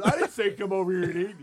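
hammed-up protesting [name]; laughing denial. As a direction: push the blame away laughing. [name] denies blame, laughing.

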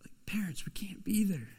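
hushed unvoiced whisper. Real, not acted. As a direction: lean in and whisper it. Aside to parents.

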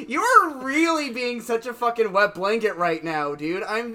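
loud, exasperated rant. Retold or imitated. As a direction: scold the wet blanket. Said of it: "And I'm like".

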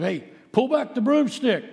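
high-pitched urgent call. Retold or imitated. Atkinson calling to his friend holding the broomstick, high urgent call. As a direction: call it out sharply. Boy calls out mid-story.

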